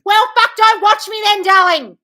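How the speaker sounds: loud, high furious shout; real yelling. Real, not acted. Host telling triggered viewer to leave, furious yell. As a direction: blast it at them.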